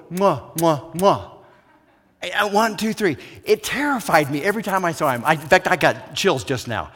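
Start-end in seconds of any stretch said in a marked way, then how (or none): 0.1-1.3 loud cheek kisses. Retold or imitated. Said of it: "and then he'd go".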